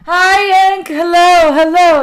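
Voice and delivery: high, very loud singsong hello; belted-out, high-pitched hello; loud greeting. Real, not acted. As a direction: call out a big singsong welcome. Greeting a chatter on stream.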